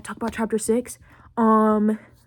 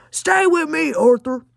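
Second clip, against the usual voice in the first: raised, higher-pitched rallying cry; urgent mock plea; 7 semitones higher, 6 dB louder, slower than usual. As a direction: imitate a gang leader calling his man back. Imitates gang leader urging Arthur.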